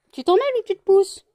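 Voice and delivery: high, loud pet telling-off; scolding. Real, not acted. Owner to pet amid a scuffle, high loud scolding.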